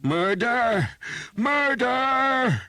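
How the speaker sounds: very high, very loud shrieks; shrieking alarm. Acted. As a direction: scream for anyone in town to hear. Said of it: "he shrieked the alarm".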